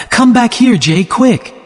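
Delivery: sudden high eager call; cried out. A narrator's reading. Daisy calling Jay to the window to see the sunset: excited call. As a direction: call him over urgently, delighted. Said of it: "Daisy suddenly cried out".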